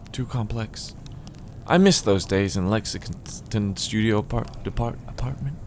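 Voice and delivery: a hint of a nostalgic character; wistful former romance writer. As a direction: play a writer reminiscing about better days. Reader plays Amy, novelist.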